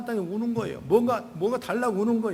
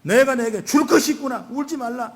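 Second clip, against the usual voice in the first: a lightly voiced Jesus, calling out louder and higher; Jesus' comforting voice; 5 semitones higher, 5 dB louder than usual. Jesus speaks to the grieving believer, fervently calling out. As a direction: proclaim the comfort with fervour. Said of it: "이렇게 말씀하신 거예요".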